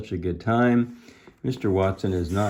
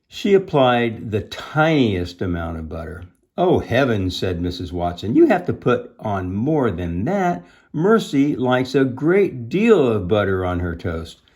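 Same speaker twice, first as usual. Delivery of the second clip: emphatic, louder storybook dialogue; animated story reading; 3 semitones higher, 7 dB louder than usual. A narrator's reading. Missus Watson correcting Eugenia's buttering, animated storytelling. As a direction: lean on the amounts of butter.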